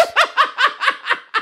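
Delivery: bursting into high laughter; a long, high laugh. Real, not acted. Cohost laughs at the imagined parents teasing Flex, high laughter. Joking about Flex's parents.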